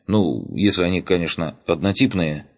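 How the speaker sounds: ordinary delivery, nothing marked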